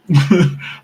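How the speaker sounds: sudden loud, high laugh; short laugh. Real, not acted. Streamer to viewers amid tech trouble, loud short laugh. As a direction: burst out in a brief laugh.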